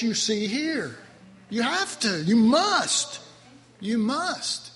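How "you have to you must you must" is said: ordinary talk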